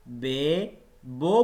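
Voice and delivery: drawn "be, bo" with long vowels; majhool way. Shown as a demonstration. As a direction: stretch the vowels like Urdu "be" and "bo". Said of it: "तरीका मजहूल कहलाता है". (मजहूल तरीके से लंबा "बे, बो")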